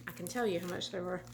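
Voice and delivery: ordinary talk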